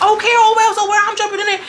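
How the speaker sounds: high-pitched imitated comeback; mock dismissive retort. Retelling one castmate answering another, high mock retort. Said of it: "she was like".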